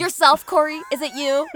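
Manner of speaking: loud, high teasing call. Real, not acted. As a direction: tease him loudly and high.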